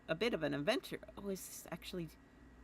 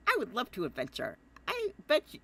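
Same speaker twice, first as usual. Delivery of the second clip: put-on higher, eager boy voice with a laugh; Jake voice; 5 semitones higher, 4 dB louder than usual. Asked by the player to do Jake, high and giddy. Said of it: "Do the Jake voice".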